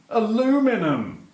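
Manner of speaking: high, loud "aluminum", stressed on LU; incredulous, American-style "aluminum"; mocking American pronunciation. Shown as a demonstration. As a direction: throw back "aluminum" as a-LOO-mi-num, in mock disgust. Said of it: "In America, aluminum".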